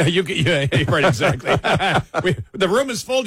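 laughing. Real, not acted. Mocking joke with laughter.

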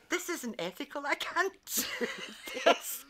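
high, laughing protest; laughing disbelief. Retold or imitated. Recalling her own thoughts about the call, laughing. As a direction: crack up mid-sentence. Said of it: "And I thought".

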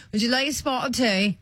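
a put-on posh British accent, badly done; a bad British accent on purpose; mock British accent. Shown as a demonstration. Radio host mocking a British tea offer in a bad British accent. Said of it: "That's awful, isn't it?".

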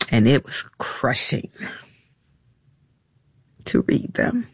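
halting, with long pauses, struggling to get it out; fighting back emotion; choked up. Real, not acted. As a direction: voice failing to a near whisper. Recalling painful emails, choked up.

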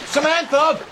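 urgent call of her name. Acted. Daughter pulled from the pool.

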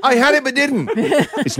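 talking through real laughter; laughing. Real, not acted. Host retracting a wrong TV guess, laughing. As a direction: laugh your way through the admission.

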